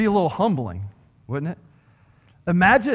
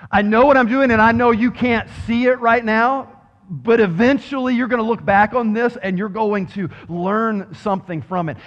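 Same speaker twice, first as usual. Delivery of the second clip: emphatic reassurance; 5 semitones higher, 5 dB louder than usual. Acted. Jesus reassures, loud repeat.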